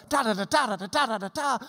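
rhythmic TA-DA-DA patter; rat-a-tat rhythm. Shown as a demonstration. Teacher mimics the rhythm of the Greek, rat-a-tat. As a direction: drum the syllables out evenly. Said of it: "rat-a-tat formula".

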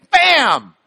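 loud punchy bam; excited chef exclamation; Emeril's bam. Retold or imitated. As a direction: shout bam like a TV chef adding spice. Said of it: "Emeril uses when he wants to emphasize".

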